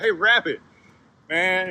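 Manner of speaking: calling out. Acted. Man turns to the departing rabbit, calling out. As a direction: call back to a friend walking off.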